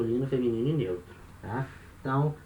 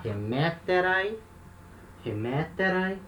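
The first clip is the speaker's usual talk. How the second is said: higher-pitched "Remeterai", weight on second syllable ME; accent kept in place; 4 semitones higher, slower than usual. Shown as a demonstration. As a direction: stress "Remeterai" on ME, ending rising. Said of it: "O acento permanece no mesmo lugar".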